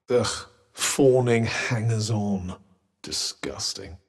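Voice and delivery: slow, low contempt; revolted, dropping almost to a whisper; disgusted. Acted. Recoils at fawning followers.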